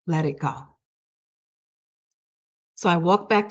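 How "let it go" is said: soft, low-key retold message; gentle inner voice. Something speaks to the searching assistant, soft gentle counsel. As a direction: say it softly, like guidance arriving within.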